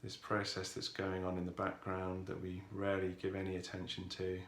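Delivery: calm, softened voice with long pauses; guided meditation. Real, not acted. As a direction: ease listeners into stillness, unhurried and even.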